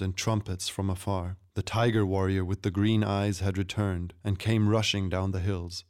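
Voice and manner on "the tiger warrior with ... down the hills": usual speaking voice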